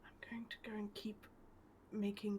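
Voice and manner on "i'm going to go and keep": whisper. Pretend. Winding down, others asleep nearby.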